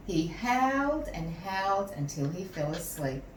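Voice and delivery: ordinary delivery, nothing marked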